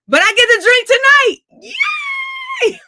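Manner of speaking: high, loud celebratory cheer; gleeful shout. Real, not acted. Singer to fans, cheering that she gets to drink tonight. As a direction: crow about the treat tonight.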